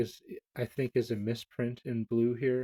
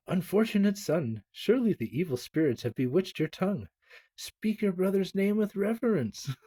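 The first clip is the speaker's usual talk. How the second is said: scolding mother; 8 semitones higher than usual. Acted.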